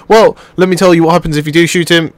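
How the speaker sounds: ordinary talk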